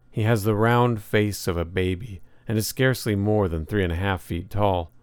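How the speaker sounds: ordinary delivery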